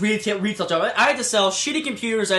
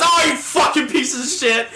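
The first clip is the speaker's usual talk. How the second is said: high mock outburst; hammed-up angry swearing; irate customer; 6 semitones higher, 3 dB louder than usual. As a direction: imitate an old customer cursing someone out. Retold irate old customer.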